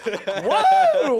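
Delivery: loud, high imitated pilot cheer; hammed-up elated yell; celebratory whoop. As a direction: whoop like you just survived a landing.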